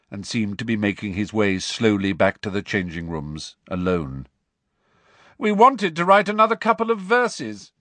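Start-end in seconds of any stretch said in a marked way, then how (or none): 5.4-7.7 high taunting call; calling out tauntingly. A narrator's reading. Malfoy jeers at Harry's team after the match, taunting call. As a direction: call it out high to needle them. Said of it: "Malfoy called".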